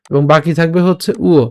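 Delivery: ordinary talk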